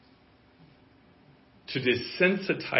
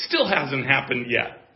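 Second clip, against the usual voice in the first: ordinary talk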